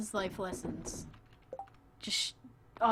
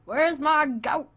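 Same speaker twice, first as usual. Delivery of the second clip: mock Southern drawl, loud and slow; imitating a country accent; deep country accent; 6 semitones higher, 9 dB louder, slower than usual. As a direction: call out like a country woman missing her goat. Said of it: "in her deep country accent".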